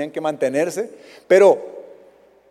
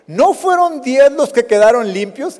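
higher, louder rising question; raised questioning; 6 semitones higher, 5 dB louder than usual. Retold or imitated. Jesus asking where the nine went, higher and louder. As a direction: ask it out loud, puzzled. Jesus asks about the ten.

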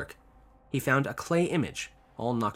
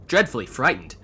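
plain reading voice

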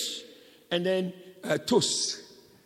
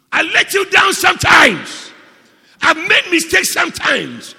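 high, shouted admission; loud, pleading preacher's shout; Peter's impassioned confession; 8 semitones higher, 13 dB louder than usual. Acted. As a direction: cry out to the Lord. Acts out Peter confessing to the Lord.

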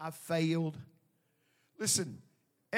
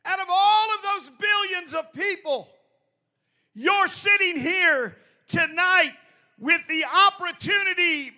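loud sharp voice; 6 semitones higher, 8 dB louder than usual. Real, not acted. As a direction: shout it out like a fired-up preacher. Preaching climax, shouting.

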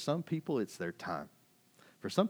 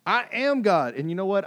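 indignant God; 11 semitones higher, 12 dB louder than usual. Acted. God confronting someone who doesn't recognize him, indignant. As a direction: proclaim it with booming force.

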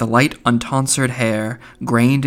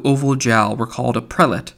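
plain reading voice

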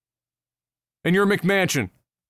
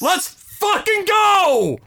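high, slow, drawn-out battle cry; hype yell; 11 semitones higher, 4 dB louder, much slower than usual. Pretend. Picturing stepping out to brawl the driver, roaring high and slow. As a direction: psych yourself up for a fight. Imagining fighting the Ontario driver.

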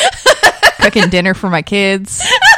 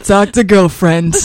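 slow deadpan voice; slower than usual. Pretend. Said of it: "I say it just like that".

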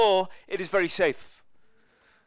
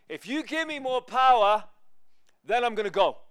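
high, loud conditional promise; acted bargaining; 6 semitones higher, 6 dB louder than usual. Believer setting terms with the Lord, loud bargaining. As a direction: strike a deal with God at full voice.

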